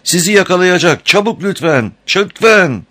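high, pleading, desperate yell; full-force shout. A narrator's reading. Amber pleading as the crocodile closes in, shouting. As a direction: beg at the top of your voice. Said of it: "var gücüyle bağırıyordu".

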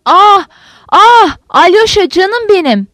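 high, loud cries of grief; broken, anguished wail. A narrator's reading. Kaledin's wife over his body, broken anguished wail. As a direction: cry out high in horror and heartbreak. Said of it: "karısının kırık, korkunç, tanınmaz sesini duydu".

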